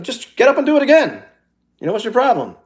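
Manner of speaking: mock the cocky advice-giver; mock confident person. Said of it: "that very confident people say".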